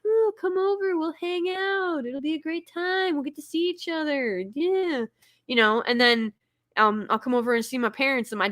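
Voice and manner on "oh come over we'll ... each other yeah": high, sugary forced invitation; fake cheerful. Inviting friends she dreads seeing, forced bright cheer. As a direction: sound thrilled while hating it. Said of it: "And I'm like".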